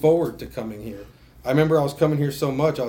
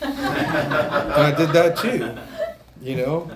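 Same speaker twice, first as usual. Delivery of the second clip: slow, louder, laughing through the words; 4 semitones higher, 5 dB louder, much slower than usual. Real, not acted. Telling the group he obeyed, laughing. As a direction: laugh it out at yourself. Laughing at his own compliance.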